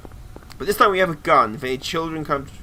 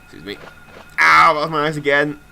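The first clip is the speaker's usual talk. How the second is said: ordinary delivery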